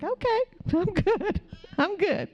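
high, laughing okay. Retold or imitated. Laughing it off at the woman's parting remark. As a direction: laugh it off lightly.

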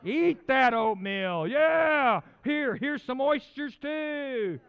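loud, high, drawn-out mock coaxing; hammed-up urging. Speaker mimics urging someone to eat oatmeal, loud urging. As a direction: push it out big and slow like a pitchman.

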